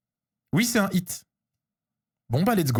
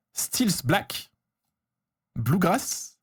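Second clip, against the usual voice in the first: English card names in a French accent; much slower than usual. Real, not acted. Streamer reading out card names to viewers, French accent. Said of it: "avec l'accent français".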